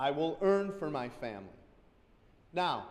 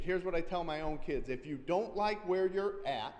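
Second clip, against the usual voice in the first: normal talking voice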